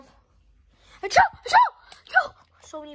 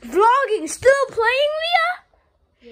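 vlog shout; 6 semitones higher, 13 dB louder, much slower than usual. Real, not acted. Vlog announcement.

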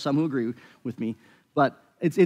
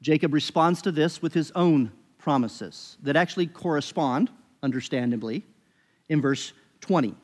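usual speaking voice

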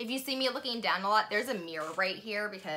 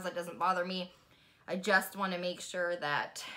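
face stiff under wet sheet; wearing a sheet mask. Real, not acted. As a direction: lips framed by mask opening. Seen 1: Sheet mask on face throughout, mouth opening uncovered.

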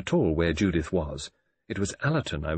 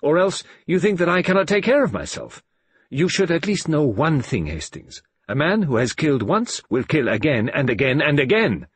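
put-on louder, insistent old detective; Poirot's grave warning; 6 semitones higher, 4 dB louder than usual. A narrator's reading. Poirot warns Hastings a killer kills again, louder. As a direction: drive the warning home.

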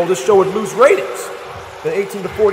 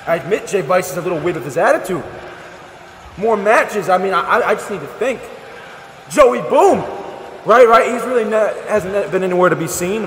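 ordinary talk

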